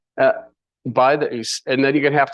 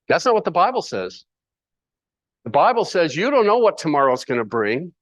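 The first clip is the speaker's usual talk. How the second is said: ordinary talk, nothing marked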